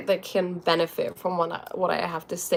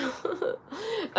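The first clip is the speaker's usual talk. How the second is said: helpless laugh; 11 semitones higher, 11 dB quieter than usual. Real, not acted. Cat on her bed.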